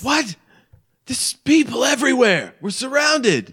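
high, loud panicked creature voice; panicked shriek. Acted. Furbolg crying out to her friend, a high panicked shriek. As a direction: shriek in alarm at the crowd.

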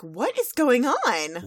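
loud, drawn-out mock bewilderment; alarmed demand. Twilight arriving at the chaos, loud drawn-out alarm. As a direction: stretch it out loud and high. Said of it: "Twilight Sparkle and Spike show up".

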